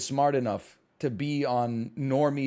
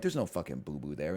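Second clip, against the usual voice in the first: much softer, lower under-the-breath grumble; exasperated mutter; 13 semitones lower, 11 dB quieter than usual. Retold or imitated. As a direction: mutter it so the kid won't hear. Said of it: "I'm like".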